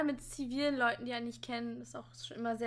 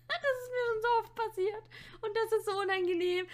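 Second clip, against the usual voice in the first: laughing; 8 semitones higher than usual. Real, not acted. Laughing about embarrassing mistake.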